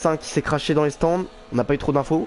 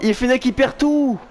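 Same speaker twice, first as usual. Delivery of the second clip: excited; 9 semitones higher than usual. Real, not acted. Reacting to driver's loss.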